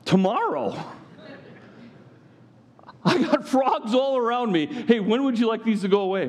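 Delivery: comic Pharaoh. Acted.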